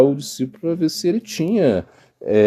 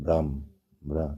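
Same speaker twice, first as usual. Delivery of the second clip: fading moan; 8 semitones lower, 8 dB quieter, much slower than usual. Retold or imitated.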